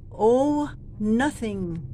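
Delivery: very slow, long Owe; drawn-out owe. Shown as a demonstration. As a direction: stretch Owe nothing to anyone. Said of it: "Habaan din ng konti".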